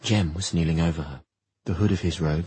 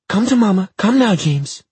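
loud, high desperate coaxing; pleading mother; 9 dB louder than usual. Acted. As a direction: coax the child back urgently. Mother calling child.

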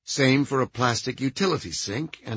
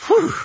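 big relieved exhale; relieved whew; 8 dB louder than usual. A narrator's reading. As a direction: blow out the tension.

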